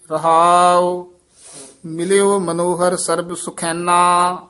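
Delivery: slow, measured Gurbani recitation; scripture recitation. Acted. Teacher reciting Gurbani for learners, measured chant-like reading. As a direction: hold the long vowels, pausing between phrases.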